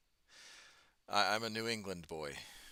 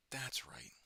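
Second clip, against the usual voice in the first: whispered, higher and softer repeat; 6 semitones higher, 5 dB quieter than usual. Real, not acted. Whispering a reminder to himself mid-game. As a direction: breathe it to yourself as you remember.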